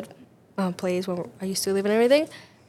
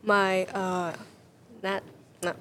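halting, searching for the English word; translating in head; 2 semitones lower, 7 dB quieter, much slower than usual. Real, not acted. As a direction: pause and grope for the right word. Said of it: "how do you say this in English?".

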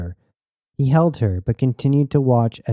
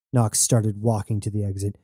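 ordinary delivery, nothing marked

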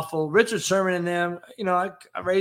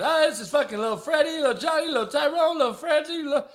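higher, fast mock voice listing kids; 10 semitones higher, faster than usual. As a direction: rattle off the names in a mocking voice. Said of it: "[name], come out here and tell us".

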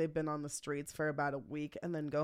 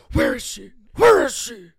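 surface-level growl building louder; Batman voice; 4 semitones higher, 13 dB louder than usual. Shown as a demonstration. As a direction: push the Batman rasp, growing loud. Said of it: "it has to be surface level".

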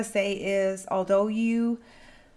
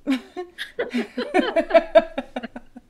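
laughing; 9 semitones higher, 4 dB louder than usual. Real, not acted. Laughing at own question.

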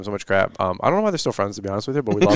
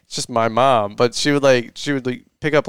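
ordinary talk, nothing marked

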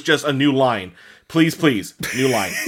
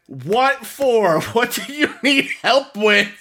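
high, baffled reaction; puzzled what-for; mock baffled reply while reading a post; 9 semitones higher, slower than usual. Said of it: "I've read this and I went".

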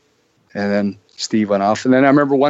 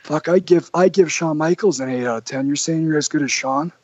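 imitating Johnny; 5 semitones higher than usual. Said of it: "Johnny goes".

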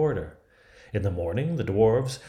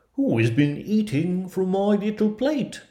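very high, loud dwarf voice; squeaky storybook dwarf, demanding answers; indignant dwarf; 10 semitones higher, 5 dB louder than usual. Acted. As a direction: pitch it right up as a put-out dwarf. Second dwarf finds his plate used.

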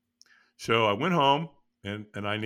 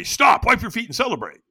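loud, high called-out order; shouted command; 10 semitones higher, 6 dB louder than usual. Retold or imitated. Crew members at the coals shouting orders at the walker, loud and high. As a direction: bark it like a crew calling to the walker. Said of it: "they say".

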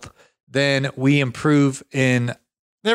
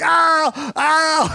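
imitating a pained scream; 13 semitones higher than usual. Friend in back pain screaming on the floor, mock pained cry. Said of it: "he starts screaming".

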